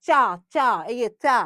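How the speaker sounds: চা said crisply, tongue tapping the palate; demonstrating cha. Shown as a demonstration. Teacher shows students where চা touches the palate. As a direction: tap the palate hard on চা. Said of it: "চ দিয়ে দেখো".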